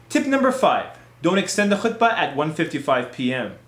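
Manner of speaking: ordinary delivery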